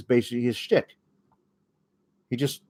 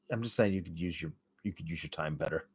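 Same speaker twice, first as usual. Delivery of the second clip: much softer, fast, half to himself; muttered aside; 9 semitones lower, 17 dB quieter, faster than usual. Real, not acted. Podcaster grumbling about wasted airtime, quiet and fast. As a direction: mutter it under your breath, quick.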